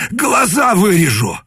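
high angry threat; menacing threat. Acted. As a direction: spit the threat with knife drawn. Knife to his face.

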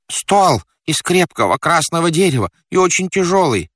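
high, urgent character voice; flustered fat man. Acted. Stranded fat man gives instructions. (суетливый толстяк, высоким голосом)

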